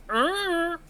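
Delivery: a high, clueless shrugging "Uh"; shrugging grunt. Retold or imitated. Husband shrugging at his angry wife, high clueless grunt. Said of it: "He's, like, shrugging".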